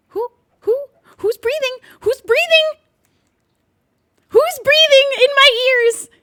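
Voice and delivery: high, loud, slow alarmed cry; spooked yell. Real, not acted. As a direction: call out, spooked. Spooked by breathing sounds.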